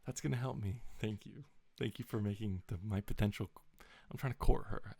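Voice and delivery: usual speaking voice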